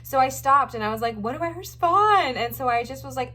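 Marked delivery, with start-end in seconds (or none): 1.2-2.3 higher and louder, caught off guard; suddenly stuck for an answer; flustered inner panic. Retold or imitated. Asked her job by the therapist, flustered higher louder panic.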